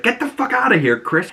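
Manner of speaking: high, sharp retold outburst; mock angry yell. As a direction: throw him out with a hammed-up yell. Harcourt yelling at Chris.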